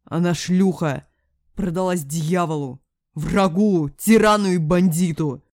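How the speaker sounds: loud, furious denunciation; angry shouting; enraged father. A narrator's reading. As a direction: hurl accusations, building in volume. Enraged father denounces the mother.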